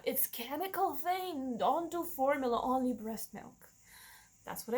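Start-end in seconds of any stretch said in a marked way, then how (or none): none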